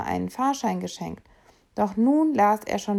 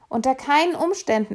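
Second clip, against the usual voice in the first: firm; 6 semitones higher, 4 dB louder than usual. Acted. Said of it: "erklärte Emil fest".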